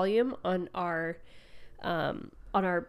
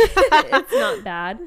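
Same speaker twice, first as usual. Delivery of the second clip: laughing; 8 semitones higher, 9 dB louder, much slower than usual. Real, not acted. Laughing after burping on mic.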